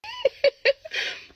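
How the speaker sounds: loud, very high-pitched laugh; high laughter. Real, not acted. Storyteller cracks up before resuming, high laughter.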